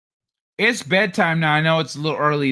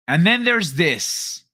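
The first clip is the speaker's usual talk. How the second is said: usual speaking voice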